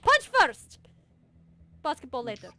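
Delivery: loud, high cheerful command; rally them on; loud eager call. Acted. Eager sister leads.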